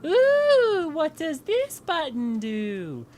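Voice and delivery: very high, drawn-out cartoon voice; singsong mischief, stretched slow and high; curious troublemaker squeal; cartoon pest. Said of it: "Dexter's Lab, uh, reference in there".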